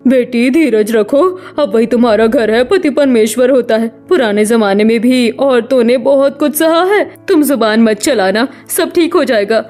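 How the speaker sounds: consoling mother. Acted. Mother calming her married daughter by phone, consoling. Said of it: "Radha के घर वाले उसे तसल्ली देकर".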